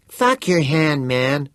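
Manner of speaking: annoyed. Acted. Vern snaps at Teddy.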